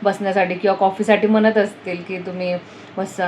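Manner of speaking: ordinary talk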